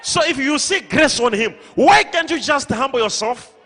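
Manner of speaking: loud, forceful pulpit rebuke; full-force preaching. Real, not acted. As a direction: preach it hard, pleading with the stubborn.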